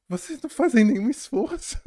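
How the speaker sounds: high, loud outburst; heated retort. Retold or imitated. Telling the class bully off, high and loud. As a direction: snap back at him, high and loud. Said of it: "Daí eu falo".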